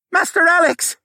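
high, loud muffled shout; loud, high cry for help. Acted. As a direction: yell for rescue from behind a door. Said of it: "the koala man shouted".

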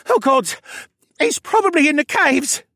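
high, loud, panicky whine; whining. A narrator's reading. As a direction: whine it like a terrified captive. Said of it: "the man whined".

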